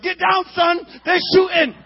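high, loud warning shout; neighbors yelling. Retold or imitated. Neighborhood men warning the kids of gunfire, loud yelled warning. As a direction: yell at the kids to duck. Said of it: "All the brothers in the neighborhood like".

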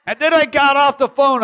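high, loud exasperated cry; loud high exasperation; frustrated outburst. Retold or imitated. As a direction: blurt it out, fed up. Frustrated outburst after phone call.

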